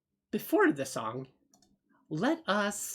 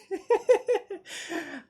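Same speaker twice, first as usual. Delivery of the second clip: laugh; 13 semitones higher, 4 dB louder than usual. Real, not acted. Amused laugh at song choice.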